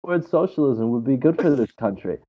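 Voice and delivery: offhand, sighing provocation; nonchalant with a sigh. Retold or imitated. Baiting a conservative crowd, sighing and offhand. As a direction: say it lightly to stir people up. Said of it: "just nonchalantly just be like".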